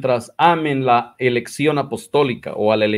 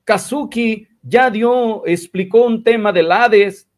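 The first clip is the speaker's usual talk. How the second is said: higher-pitched mock retelling of a gossip; lightly voiced tale-bearer, pitched up; Vilchis; 7 semitones higher than usual. Gossip telling brother Israel about Kazuki, lightly imitated in a higher voice. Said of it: "El Vilchis le dijo".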